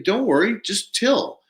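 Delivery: high, lighthearted reassurance; reassuring. Retold or imitated. Reassuring panicked farmer in retold call.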